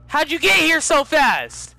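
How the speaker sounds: loud, high, slow disbelieving yell; real astonished yell; shouting in disbelief. Real, not acted. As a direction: shout it amazed. Shouting at fast character.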